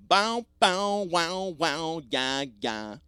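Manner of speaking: loud, high mouthed guitar twang; sung guitar riff. Shown as a demonstration. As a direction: imitate a twangy riff with the voice, falling away. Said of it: "like, a guitar riff".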